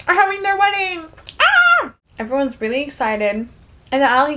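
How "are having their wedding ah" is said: high sing-out rising to a shriek; giddy announcement. Pretend. Narrator announcing the couple's wedding, squealing with excitement. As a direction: build into a loud delighted squeal.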